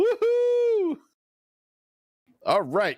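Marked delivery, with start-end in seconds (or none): 0.0-1.0 excited woohoo. Real, not acted. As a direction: whoop it up like a fan. Cheering a drink from a shoe.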